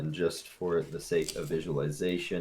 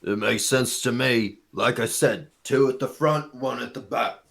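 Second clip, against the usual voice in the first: gruff bugbear voice; 6 semitones higher, 5 dB louder than usual. Acted. Bugbear guard replies.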